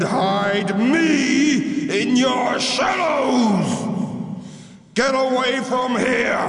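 high, slow threatening shout; angry shouting giant. Acted. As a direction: bellow a threat down at them. Giant mountain threatens the two.

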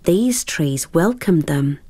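ordinary delivery, nothing marked

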